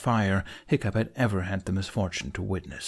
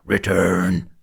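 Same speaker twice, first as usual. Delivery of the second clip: louder, hoarse monster roar; hoarse demon scream; 3 semitones lower, 6 dB louder than usual. A narrator's reading. Demon calling to the clouds, hoarse roar. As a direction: rasp it out to the sky. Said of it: "the demon screamed hoarsely".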